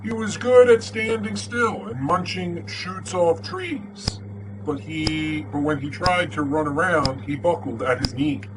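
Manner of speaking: lively picture book read-aloud; storybook voice for kids; story narration. Acted. Reads second book aloud.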